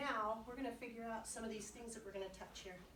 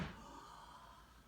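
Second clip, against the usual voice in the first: quiet breath in; 11 dB quieter than usual. Shown as a demonstration. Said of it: "one more time".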